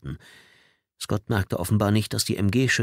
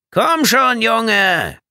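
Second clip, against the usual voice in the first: loud, high, drawn-out yell; calling out; 13 semitones higher, 8 dB louder, slower than usual. A narrator's reading. Bedford urging the airman to fetch the ball, shouting. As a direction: holler it impatiently over a distance. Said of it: "rief Bedford".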